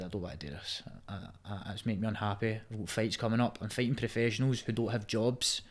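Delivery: retold quietly to his fiance's parents; quiet retold plea. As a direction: murmur it like a confession you half dread. Retelling telling fiance's parents he quits.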